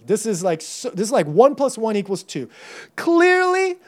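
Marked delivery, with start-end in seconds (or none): 3.0-3.8 CLEARLY pitched very high; emphatic obviousness. Acted. Preacher rereading the verse as so obvious, high emphatic "Clearly". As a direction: lift "Clearly" high as self-evident. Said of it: "He's saying this is so obvious".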